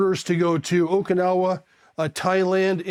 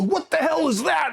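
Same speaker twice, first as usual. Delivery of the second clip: company commander's demand, pitched way up; mock outraged commander; 10 semitones higher than usual. Commander finding a surfboard during field day, high mock outrage.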